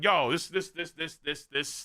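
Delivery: ordinary talk, nothing marked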